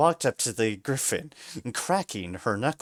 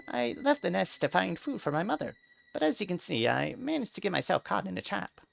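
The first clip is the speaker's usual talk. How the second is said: high, small begging mouse voice; higher, speeding up at the end; pleading mouse; 7 semitones higher, faster than usual. A narrator's reading. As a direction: beg for your life, squeaky. Mouse begs for help.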